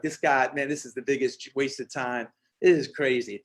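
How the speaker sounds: low, dismissive grumble of doubters; scoffing friends. Retold or imitated. Friends on the phone mocking the plan, low scoff. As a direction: scoff like a friend who thinks it's a waste.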